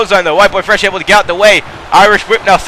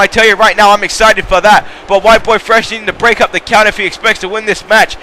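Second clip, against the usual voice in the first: normal talking voice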